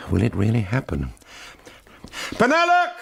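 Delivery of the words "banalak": loud, high call into the dark. Acted. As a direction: shout to flush him out. Calling into a dark gallery.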